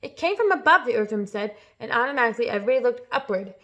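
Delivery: ordinary delivery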